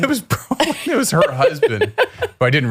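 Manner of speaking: real laughter breaking into the reveal; laughing. Real, not acted. Laughing at the punchline.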